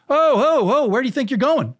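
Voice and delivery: mechanic stopping them. Retold or imitated. Mechanic calls the family back, high mock holler. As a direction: playfully halt the departing guests, pitched well up. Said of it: "And the mechanic goes".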